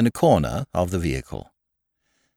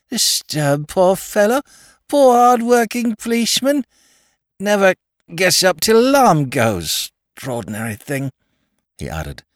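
high, slurred outraged lord; indignant drunk toff; 9 semitones higher than usual. Acted. Drunk lord scolding Sugg for waking Parker, high and slurred.

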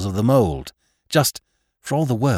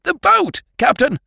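high, loud frightened cry; shouting to the captain in fear, much higher and louder; 4 dB louder than usual. A narrator's reading. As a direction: yell it in panic, pitch way up. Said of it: "shouting in a queer, frightened voice".

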